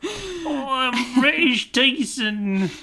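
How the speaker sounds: put-on British accent. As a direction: drag out a bad British accent. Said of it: "My British accent's worse".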